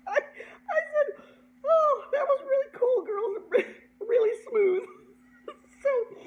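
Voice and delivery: high-pitched, helpless laughter through the words; squeaky voice breaking into laughs; laughing while talking. Real, not acted. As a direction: crack up while telling it. Cracking up while retelling.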